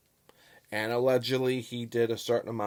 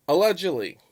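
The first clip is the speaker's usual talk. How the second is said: "Allegedly" punched loud and high, then falling; emphatic allegedly; 7 semitones higher, 7 dB louder than usual. Real, not acted. Vlogger stressing his fairness to viewers, emphatic allegedly. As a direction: lean hard on "Allegedly", pitch leaping up. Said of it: "I emphasize the word allegedly".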